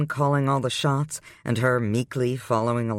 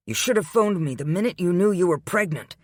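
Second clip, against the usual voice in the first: fierce; 6 semitones higher than usual. A narrator's reading. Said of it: "he said fiercely".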